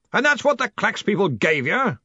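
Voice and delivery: high-pitched, blustering question; demanding. A narrator's reading. Arch-Chancellor questioning a young wizard, demanding. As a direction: demand an answer as the boss. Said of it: "the Arch-Chancellor demanded".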